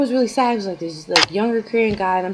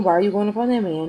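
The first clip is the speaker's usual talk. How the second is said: usual speaking voice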